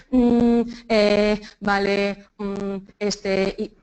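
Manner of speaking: monotone, stretched hesitation sounds; drawn-out fillers. Shown as a demonstration. Teacher showing the fillers to avoid, droning hesitation. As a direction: hold each filler on a level note. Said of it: "evito el".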